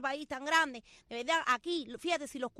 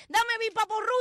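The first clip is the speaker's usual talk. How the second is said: customer demanding; 7 semitones higher, 6 dB louder than usual. Retold or imitated. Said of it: "y entra uno y dice".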